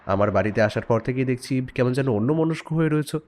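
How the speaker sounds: ordinary talk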